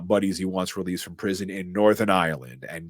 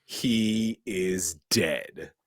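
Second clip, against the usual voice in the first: slow dramatic pronouncement; much slower than usual. Pretend. Dramatic line after the gunshot.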